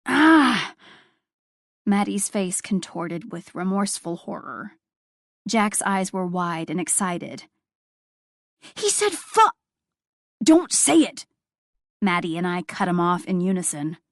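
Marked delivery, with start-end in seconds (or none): 0.0-0.7 put-on child's excited shriek. A narrator's reading. 8.7-11.2 loud high don't say it; urgent cutoff. A narrator's reading. Two adults cutting off a child about to swear, shouting.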